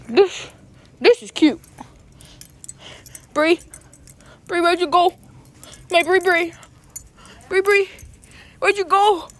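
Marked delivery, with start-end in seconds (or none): 3.3-9.3 loud high calling out. Real, not acted. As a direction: call across the store. Calling out for a lost friend.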